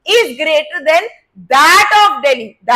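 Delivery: slow, loud "that of", word by word; stress on "that". Shown as a demonstration. Teacher inserts the missing word for students, stress on "that". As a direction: land hard on "that". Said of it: "that से हमने population को signify किया".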